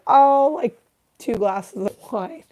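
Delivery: high "oh", then slow hedging answer; feigned casual innocence. Acted. Patient fibbing to the doctor about drinking, coy hedge. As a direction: hedge it coyly, drawing it out. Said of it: "And you're like".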